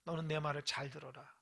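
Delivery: low, measured voice addressing a servant; God. Acted. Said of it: "그때 하나님이 약속을 나타나셔서".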